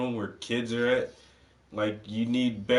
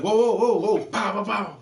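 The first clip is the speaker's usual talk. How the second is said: sudden high, loud alarmed cop with mock gunshots; startled cop; 7 semitones higher, 4 dB louder than usual. Cop retold startled by approaching dog, loud alarmed yelp. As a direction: yelp it like a panicking cop, then fire. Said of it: "he's like".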